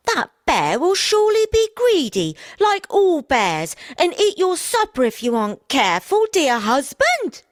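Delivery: shrill-free high warning wife voice. A narrator's reading.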